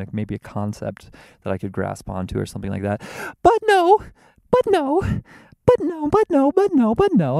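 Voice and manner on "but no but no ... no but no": very high, loud falsetto; squealed falsetto protest; squeaky mock protest. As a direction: repeat it in a piercing comic falsetto.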